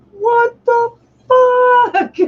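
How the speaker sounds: high, slow, laughing what the fuck; drawn-out amazed squeal through a laugh; stunned disbelief. Retold or imitated. Shock at seeing her top score.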